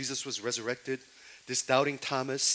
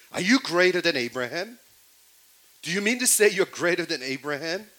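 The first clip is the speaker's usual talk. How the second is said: challenging demand; 6 semitones higher, 9 dB louder than usual. Acted.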